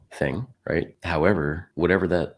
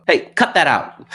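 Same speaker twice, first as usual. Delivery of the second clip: high, loud, slow scolding parent voice; 13 semitones higher, 11 dB louder, slower than usual. Retold or imitated. Telling off the shouting man, parental scolding. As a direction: scold him like a parent would. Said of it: "almost like a parent".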